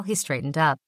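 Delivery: usual speaking voice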